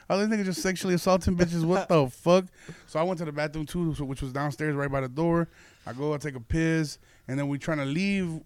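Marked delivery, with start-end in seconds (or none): none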